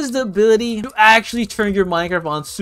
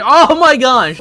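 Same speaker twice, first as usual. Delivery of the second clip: loud, higher burst; startled exclamation; 5 semitones higher, 9 dB louder, slower than usual. Real, not acted. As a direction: burst out in sudden shock. Interrupted by sudden glitch.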